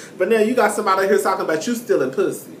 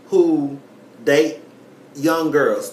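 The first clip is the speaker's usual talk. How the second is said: usual speaking voice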